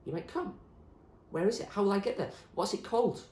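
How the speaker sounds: hopeful Zoe. Acted. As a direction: ask with new hope.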